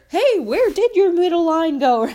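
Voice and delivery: teasing cousin. Retold or imitated. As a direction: tease a cousin about a missing line. Cousin teasing about palm line.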